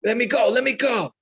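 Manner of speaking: retold screaming. Hurt fighter screaming at his coach to continue, retold screaming. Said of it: "he's, like, screaming at me".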